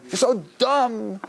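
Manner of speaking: put-on a teen ribbing a friend, slow and high; mock teen taunt. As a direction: stretch it out like a teasing teen. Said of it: "they start".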